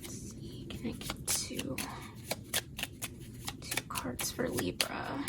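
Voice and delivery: hushed whisper to herself. Real, not acted. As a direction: whisper softly over the cards. Whispering while shuffling cards.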